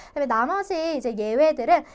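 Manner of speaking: normal talking voice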